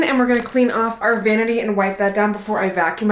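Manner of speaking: ordinary talk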